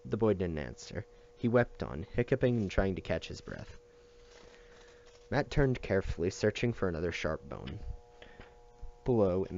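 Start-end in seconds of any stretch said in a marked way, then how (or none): none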